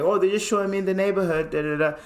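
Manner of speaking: high, quick mock-British voice; British accent. Friend throwing the cops off, high British accent. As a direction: rattle it off as a British visitor. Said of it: "kept talking in a British accent".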